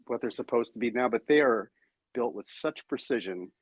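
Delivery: ordinary delivery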